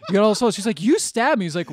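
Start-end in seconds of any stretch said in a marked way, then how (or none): 0.1-0.6 loud, laughing mock accusation; hammed-up laughing yell; mock shouted line. Retelling movie dialogue, acted quote. 0.8-1.4 high, loud indignant squeal; hammed-up wounded girlfriend; mock outraged woman. Voicing woman in retold movie.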